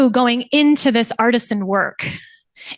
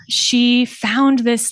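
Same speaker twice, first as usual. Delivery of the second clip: usual speaking voice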